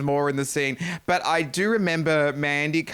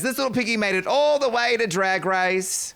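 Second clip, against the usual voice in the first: quoting the queen; 7 semitones higher, 4 dB louder than usual. Retold or imitated. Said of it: "Oh, she says".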